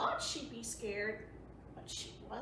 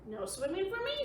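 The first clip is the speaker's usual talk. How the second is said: put-on high little-girl voice refusing; girl's high, firm no; child refusing; 8 semitones higher than usual. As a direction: pipe it up like a stubborn little girl. Nellie refusing again.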